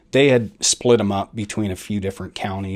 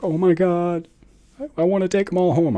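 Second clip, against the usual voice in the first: higher, tearful mock voice of the wife, rushing at the end; wife at the pound, mock tearful and higher; imitating crying wife; 9 semitones higher than usual. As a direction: lightly play the wife overwhelmed by the dogs. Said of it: "starts crying".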